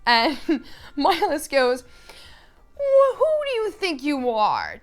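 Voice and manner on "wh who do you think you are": high, loud, pompous outrage; hammed-up offended noble; indignant swordsman. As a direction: sputter with mock aristocratic indignation. Acting swordsman's indignant challenge.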